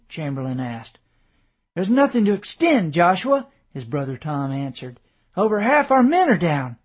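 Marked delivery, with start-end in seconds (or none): 1.8-3.5 raised and higher, pressing; urgent reply. A narrator's reading. Brother urgently answering the colonel over battle noise, raised voice. 5.4-6.8 higher and louder, alarmed; urgent report. A narrator's reading. Brother reports heavy losses.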